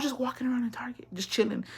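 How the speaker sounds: whispered aside; whispering. Real, not acted. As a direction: hush it like a secret.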